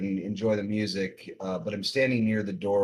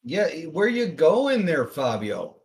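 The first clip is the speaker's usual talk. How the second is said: calling out; 7 semitones higher, 5 dB louder, slower than usual. Acted. Player calls after Fabio.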